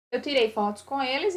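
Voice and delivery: usual speaking voice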